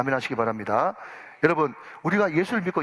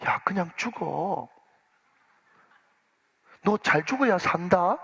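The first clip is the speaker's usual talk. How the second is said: gentle consoling; 2 semitones higher, slower than usual. Retold or imitated. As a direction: comfort him like a kind senior actor. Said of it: "잘 들으십시오. 이게 핵심입니다".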